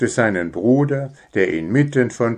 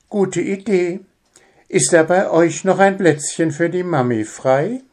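much higher, lightly voiced mum; playful mother; 9 semitones higher than usual. Mother asks to hide with them, playful. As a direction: join in conspiratorially.

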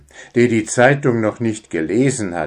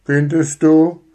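higher, louder doubtful question; sceptical; 7 semitones higher, 5 dB louder than usual. Acted. Father doubts son's enthusiasm, sceptical. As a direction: wrinkle your nose at it. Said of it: "fragte Wüli und rümpfte die Nase".

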